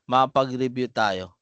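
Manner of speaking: ordinary delivery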